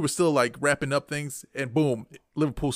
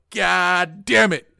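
louder, slow, drawn-out frustrated yell; retold yell; 3 semitones higher, 8 dB louder, much slower than usual. Fan retelling the tying goal, yelled in frustration. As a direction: draw out the curse as you re-enact yelling it. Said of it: "And I yelled out".

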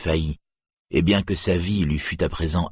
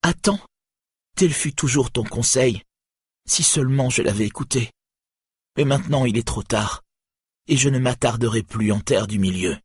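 a woman past all hope; despairing Nienor; 6 semitones higher than usual. A narrator's reading. (voix haute et désespérée)

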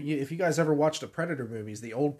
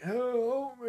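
dying voice; 11 semitones higher than usual. Retold or imitated. Predator mimics dying voice.

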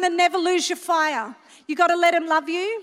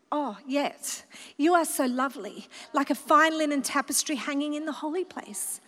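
soft tender reassurance; 5 semitones lower, 5 dB quieter than usual. Acted. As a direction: lower and softer, gentle comfort. God's reply, retold softly.

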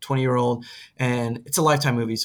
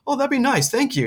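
high, delighted thank-you; pleased husband; 11 semitones higher than usual. Retold or imitated. Husband pleased by the babysitter's offer, high and flattered. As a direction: squeak it out, flattered. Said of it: "He was like".

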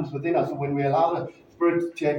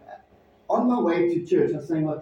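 normal talking voice

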